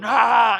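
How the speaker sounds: a short, high, loud mock yell; retold yell. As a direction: shout it at the wrestler to get his attention. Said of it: "and I'm yelling".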